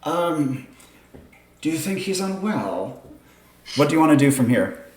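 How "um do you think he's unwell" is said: lightly voiced onlooker, softer; hesitant onlooker. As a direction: ask hesitantly, as an aside about a stranger. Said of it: "another person is like".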